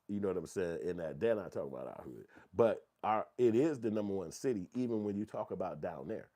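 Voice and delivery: ordinary delivery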